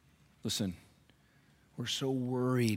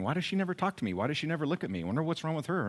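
higher-pitched, mock-puzzled grumbling; wondering complaint; 7 semitones higher, faster than usual. Churchgoer wondering about another woman, mock-aggrieved. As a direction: act out a petty, puzzled complaint. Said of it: "you stop wondering".

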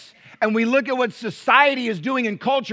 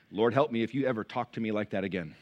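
mama's warning prayer; 9 semitones lower, 7 dB quieter than usual. Retold or imitated. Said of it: "That's the mama's prayer".

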